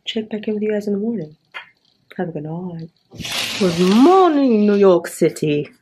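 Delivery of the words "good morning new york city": louder and slow, calling out a big hello; cheerful loud greeting. Real, not acted. Vlogger greeting New York City on camera, loud and slow.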